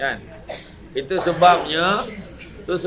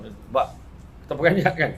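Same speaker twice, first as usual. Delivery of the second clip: usual speaking voice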